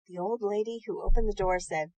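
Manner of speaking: ordinary delivery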